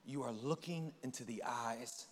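soft, fading intimate voice; tender Jesus. Retold or imitated. Jesus speaking softly to Nick, tender and hushed. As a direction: draw him in gently.

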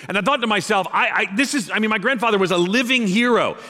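high, loud, rousing storytelling; impassioned preacher honouring a hero; full-force preaching. Real, not acted. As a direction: preach the story with rising fervour.

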